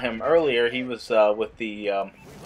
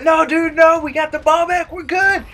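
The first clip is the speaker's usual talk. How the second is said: high, reassuring; calm him down, mock soothing; lightly imitated comforting; reassuring friends; 14 semitones higher, 4 dB louder than usual. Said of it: "And we're like".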